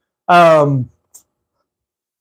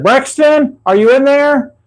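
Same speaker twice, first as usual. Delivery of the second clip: calling into the trunk; 8 semitones higher, slower than usual. Retold or imitated. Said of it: "and said".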